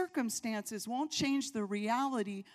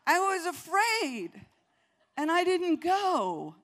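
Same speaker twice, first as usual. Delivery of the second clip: higher, louder, slow stressed words; emphatic confession; 6 semitones higher, 7 dB louder, slower than usual. Real, not acted. Preacher confessing her fear to congregation, slow and emphatic. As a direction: drive home the confession.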